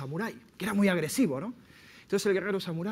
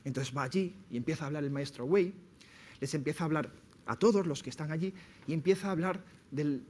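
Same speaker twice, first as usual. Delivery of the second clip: normal talking voice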